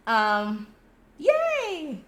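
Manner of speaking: high-pitched drawn-out yay; excited cheer. Real, not acted. Host cheering her viewers' yes replies, excited. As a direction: let out a happy high cheer.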